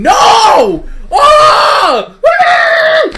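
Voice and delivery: very high, loud pained shouts; retold agonized yelling. Reliving watching the movie with his cohost, anguished yelling. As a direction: scream like it physically hurts. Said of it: "I'm sitting here going".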